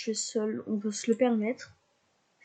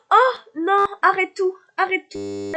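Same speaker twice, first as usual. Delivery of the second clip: high and louder, urgent; panicked shout; 7 semitones higher, 7 dB louder than usual. Real, not acted. Streamer yelling at her Sim to stop, panicked shout.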